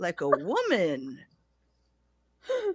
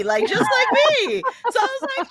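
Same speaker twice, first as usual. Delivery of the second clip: laughing; 8 dB louder than usual. Real, not acted. Repeated with a laugh.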